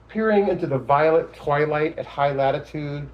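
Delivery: deliberate, lifted poem delivery; poetry reading. Acted. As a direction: read it out as a poem. Recites own poem.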